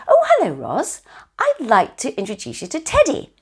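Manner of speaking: high, bright introduction; greeting Roz and introducing Teddy, cheerfully; cheery hello. Shown as a demonstration. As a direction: sing out the greeting to your sister. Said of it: "I say".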